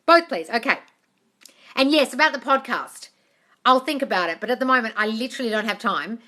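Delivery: usual speaking voice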